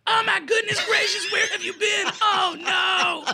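screechy, very high hammed-up impression; loud falsetto mock alarm; mock fiancée voice. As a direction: shriek like a caricature of a worried fiancée. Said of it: "every time you do her voice".

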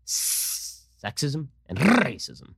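long hissed S on SEXISM, rolled R on RACISM; mock dramatic sibilance. Host mocking the predicted line, hissed SEXISM and trilled RACISM. Mocking words with exaggerated sounds.

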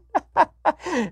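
a high mocking giggle. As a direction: giggle like the wife can't stop. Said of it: "literally laughing".

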